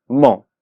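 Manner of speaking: mo on its own, as the strong syllable of amó; stressed syllable isolated. Shown as a demonstration. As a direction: say mo alone with full stress. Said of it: "en la última sílaba".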